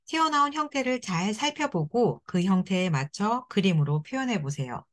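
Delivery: ordinary delivery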